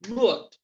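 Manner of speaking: "Mood" said in one single beat; one syllable. Shown as a demonstration. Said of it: "Un solo golpe". ("Mood" de un solo golpe)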